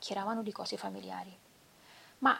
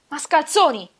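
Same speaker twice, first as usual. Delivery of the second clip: furious yell, much louder and higher; shout; 7 semitones higher, 15 dB louder than usual. Acted. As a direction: hurl the insult at the officers. (urlo furioso contro i funzionari)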